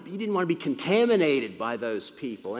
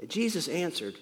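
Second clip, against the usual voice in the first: ordinary delivery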